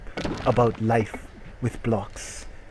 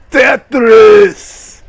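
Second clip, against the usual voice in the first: loud high triumphant yell; game-show victory yell; 11 semitones higher, 18 dB louder than usual. Acted. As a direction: scream the game's name in triumph. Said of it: "yelled the eye block".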